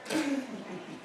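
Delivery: high, soft mad little laugh; quiet high cackle of a madman; mocking giggle. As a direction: snicker at the old man's hopes. Madman laughs at old man's hopes.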